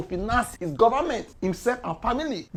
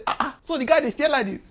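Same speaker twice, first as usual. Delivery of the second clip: mock scoffing; 6 semitones higher, 4 dB louder, faster than usual. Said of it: "Some people dey talk say".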